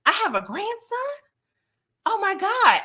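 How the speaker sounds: high, breathless disbelief; shocked gasp. Retold or imitated. Mother hearing she has a grandson, high shocked cry. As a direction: squeal it like the news just hit. Kate learns of her grandson.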